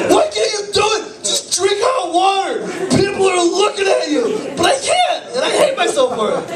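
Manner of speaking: shouting down his own shame, half laughing; self-berating yell. Real, not acted. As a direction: yell at yourself in frustration. Berating himself over hot water.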